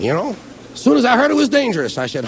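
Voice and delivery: normal talking voice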